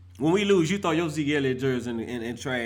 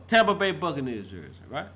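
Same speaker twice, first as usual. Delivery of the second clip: ordinary talk, nothing marked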